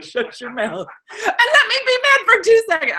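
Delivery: high-pitched, hammed-up angry outburst; retold fed-up mom losing it; strained, high yell at your kid; exasperated snapping. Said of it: "I hated every second of it".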